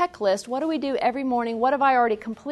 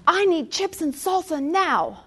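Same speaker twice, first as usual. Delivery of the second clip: high, slow mock demand; all-caps demand; 6 semitones higher than usual. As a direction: imitate a child's all-caps text, stretched out. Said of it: "in all caps".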